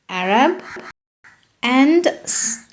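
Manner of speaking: ordinary talk, nothing marked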